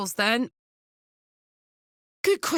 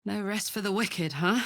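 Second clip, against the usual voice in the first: ordinary talk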